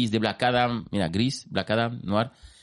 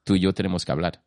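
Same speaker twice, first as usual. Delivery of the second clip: lightly acted, lower voice; character's line; 6 semitones lower than usual. Fan quoting the film's big line, lightly acted low delivery. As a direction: drop lower for a summons. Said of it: "y le dice, dice".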